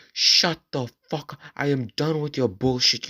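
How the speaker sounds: high-pitched mock teacher rant; retold angry outburst. Teacher to the kid he pushed down, angry imitation. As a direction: imitate a teacher at the end of his patience.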